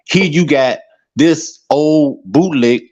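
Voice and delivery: usual speaking voice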